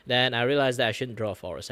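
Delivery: ordinary talk, nothing marked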